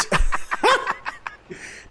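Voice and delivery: high airy burst of laughter. Real, not acted. Vlogger cracking up at his own impression, high airy laugh. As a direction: laugh at your own bit.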